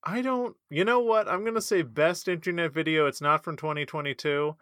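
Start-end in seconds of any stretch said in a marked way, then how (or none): none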